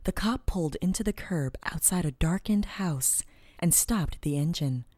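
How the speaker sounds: plain reading voice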